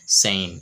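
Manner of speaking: ସେନ୍ said as "sein", rhyming with rain; correct pronunciation. Shown as a demonstration. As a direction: say ସେନ୍ like "sein", long ei vowel. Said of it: "ଏସ୍ ଇ ଆଇ ଏନ୍ ହେବା ପିଲେ". (ସେନ୍ "sein" ଭଳି ସଠିକ୍ ଉଚ୍ଚାରଣ)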